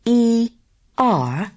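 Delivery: slow, letter by letter; spelling it out. Shown as a demonstration. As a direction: spell it back slowly to check. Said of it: "Is that R I V E".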